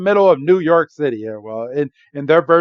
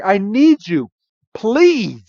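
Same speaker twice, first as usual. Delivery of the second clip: begging plea; 8 semitones higher, 3 dB louder, much slower than usual. Retold or imitated. Tim begging Pikachu.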